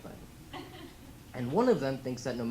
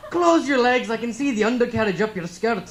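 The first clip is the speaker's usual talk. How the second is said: loud, high mock-Scottish heckle; hammed-up Scottish yelling; copied Scottish accent; 8 semitones higher, 9 dB louder than usual. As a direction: shout it as a brash Scotsman. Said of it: "the guy interviewing me is Scottish".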